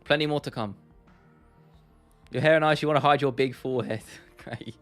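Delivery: usual speaking voice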